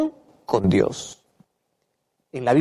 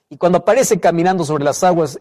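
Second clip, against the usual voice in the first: ordinary talk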